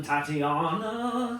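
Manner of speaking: slow, drawn-out alien voice. Acted. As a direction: stretch the name out like an alien. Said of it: "that sounded like an alien".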